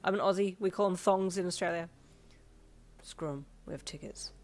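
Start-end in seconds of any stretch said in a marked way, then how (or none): 3.0-4.3 softer, muttered reply; lightly muted low reply. A narrator's reading. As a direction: keep it down near the desk. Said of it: "he replied lowly".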